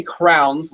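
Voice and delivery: "crowns" said slowly, one syllable like a king's crown; drawn-out "crowns" rhyming with towns; crayons said as crowns. Shown as a demonstration. Host admits his odd word for crayons, saying "crowns" slowly. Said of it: "my wife's family says it as crayons".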